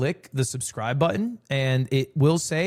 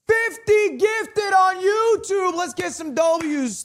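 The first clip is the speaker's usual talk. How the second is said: excited shout; 14 semitones higher, slower than usual. Real, not acted. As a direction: yell out the gifts in delight. Reacting to big gift.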